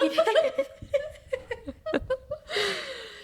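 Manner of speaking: squealing high laughter. Real, not acted. Laughing at her own pregnancy story, high giggle.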